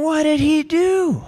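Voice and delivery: high, drawn-out incredulous question; baffled reaction. Retold or imitated. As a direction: stretch it out in acted disbelief. Puzzled thought after the yelling.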